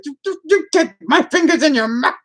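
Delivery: high, stammering pretend demand; mock yelling. Streamer to a poor cat trying to escape, mock yelling. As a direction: order the cat about, hammed-up. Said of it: "I'm yelling at a cat".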